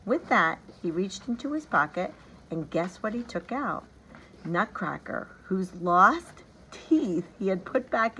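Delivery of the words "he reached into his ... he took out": ordinary delivery, nothing marked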